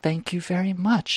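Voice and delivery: mock high lady voice, soft and sweet; a light falsetto "thank you very much"; high polite lady. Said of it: "And she goes".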